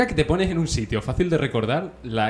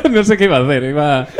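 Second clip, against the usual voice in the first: loud high giggly voice; laughing high and loud; 5 semitones higher, 8 dB louder than usual. Real, not acted. Laughing at her friend's silly voice. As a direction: laugh through the words. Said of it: "es otro personaje".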